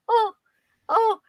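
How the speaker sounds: high-pitched mock-scared "oh"; imitated startled gasps. Imitating aunt startled by horror movie, mock fright. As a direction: act out the aunt's little frights. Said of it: "she was doing this every time something would happen".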